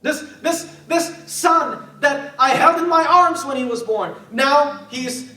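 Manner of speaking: high, halting start swelling loud; anguished grief. Acted. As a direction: plead through acted grief. Continues as the grieving mother.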